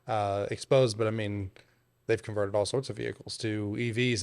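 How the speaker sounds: usual speaking voice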